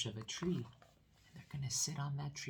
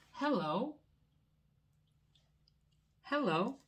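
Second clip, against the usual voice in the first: high, loud cheerful hello; child's hello; 12 semitones higher, 5 dB louder than usual. Acted. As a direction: sing out a little boy's hello. Character greeting in picture book.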